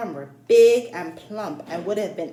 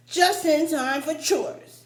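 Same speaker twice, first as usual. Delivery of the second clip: put-on higher, slow old farmer voice; slow, raised old-man greeting; old farmer's greeting; 8 semitones higher, 5 dB louder, slower than usual. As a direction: drawl it out like a gruff old farmer.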